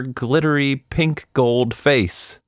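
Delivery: plain reading voice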